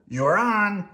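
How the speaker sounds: gruff grouchy Oscar; grumpy garbage-can grouch. Acted. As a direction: growl it like a grouch. Oscar voiced.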